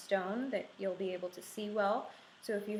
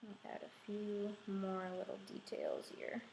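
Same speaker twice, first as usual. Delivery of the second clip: whispering; 8 dB quieter than usual. Real, not acted. Quiet aside while painting details.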